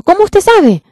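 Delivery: high, loud shocked cry; startled question. Retold or imitated. Frightened niece asking the pastor how he knew, shrieking high. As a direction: blurt it out, caught off guard. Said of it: "y se asustó".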